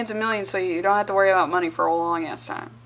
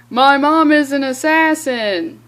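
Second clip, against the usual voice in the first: mock dramatic reveal; 3 semitones higher, 8 dB louder, slower than usual.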